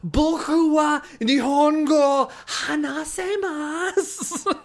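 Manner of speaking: high, slow exasperated learner Japanese; exasperated broken Japanese, slowly; japanese learner accent. Retold or imitated. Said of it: "I wanted to be like".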